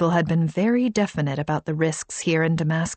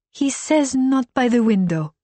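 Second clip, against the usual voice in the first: put-on higher accented translation; Arabic accent; 6 semitones higher, 3 dB louder than usual. A narrator's reading. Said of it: "Kahina translated".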